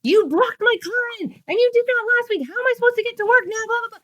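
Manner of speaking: mock shrill scolding; angry wife. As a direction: berate him as the furious wife. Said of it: "she's on the phone going".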